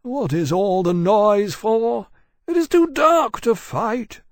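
high, loud, slow grumbling dragon voice; complaining dragon. A narrator's reading. Roused dragon objecting to the noise, complaining. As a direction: grumble loudly, dragging the words, pitched high.

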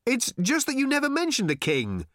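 high-pitched, put-out call; consternated shout. A narrator's reading. Arthur protesting to old man below, shouting in consternation. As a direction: shout back in some consternation. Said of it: "shouted Arthur back in some consternation".

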